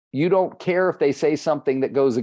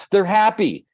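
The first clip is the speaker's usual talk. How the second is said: bright, lifted and louder reply; eager answer; 8 semitones higher, 5 dB louder than usual. Retold or imitated. Agent answering the coach with a pleased, louder reply. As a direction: blurt it out like the answer is obvious. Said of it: "He goes".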